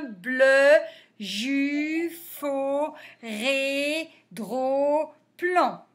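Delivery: even chant of linked syllables. Shown as a demonstration. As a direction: move steadily from syllable to syllable.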